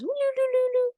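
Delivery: high looping woo-loo sound; playful imitated twisty-slide whoop; slide noise. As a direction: sing out the slide's swirl, way up high. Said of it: "that goes".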